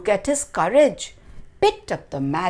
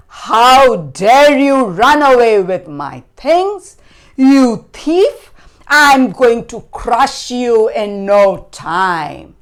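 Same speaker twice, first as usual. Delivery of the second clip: loud, raised, threatening giant; very angry; 5 semitones higher, 12 dB louder than usual. A narrator's reading. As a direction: roar the threat at the thief. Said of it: "was so angry. He said".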